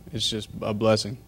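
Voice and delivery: ordinary talk, nothing marked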